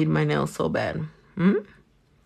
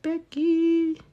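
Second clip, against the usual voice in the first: playful high call; 11 semitones higher than usual. Real, not acted. Calling friend playfully.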